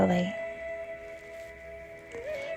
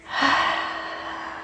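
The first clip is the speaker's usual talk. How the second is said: airy, voiceless sigh out through the mouth; long sigh; 7 dB quieter than usual. Shown as a demonstration. Guide shows a stress-relieving breath, long sigh. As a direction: let a deep breath go in a soft sigh. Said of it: "sighs out your mouth".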